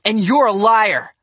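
loud, high accusation; angry shout. A narrator's reading. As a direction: yell it at him. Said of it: "I shouted".

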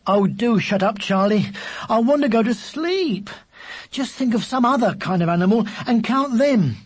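fed-up, emphatic scolding; exasperated. Acted. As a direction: plead crossly to be left to sleep. Sleepy brother scolds younger one.

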